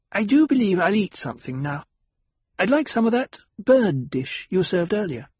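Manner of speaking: a fretful guest hoping there's no fighting; anxious lady companion. Acted. Companion speaks again.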